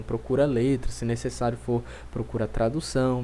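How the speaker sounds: usual speaking voice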